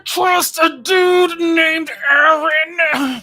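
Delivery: high strained labor screams, slowed; woman giving birth. Acted. Streamer reads joke cards as a woman in labor, high straining cries. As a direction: wail each card like you're mid-contraction. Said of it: "so the accent is giving birth".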